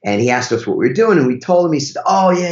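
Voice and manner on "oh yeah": higher-pitched mock Italian driver; awful Italian accent. Driver answers the visitors on the airport ride, awful Italian accent. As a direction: put on a bad Italian accent. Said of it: "That's an awful Italian accent".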